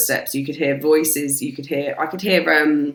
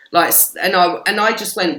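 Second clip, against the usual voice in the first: ordinary talk, nothing marked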